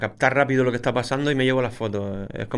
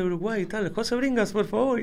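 much higher, mock-formal request from a TV station; imitating the TV caller; 11 semitones higher than usual. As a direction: lightly ham up a TV producer asking for him. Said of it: "y dice".

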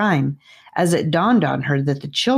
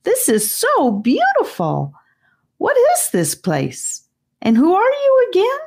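put-on high, bright wonder in a boy's voice; higher-voiced Charlie gushing with delight. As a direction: marvel at the glowing cave like a thrilled kid. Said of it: "he began to laugh".

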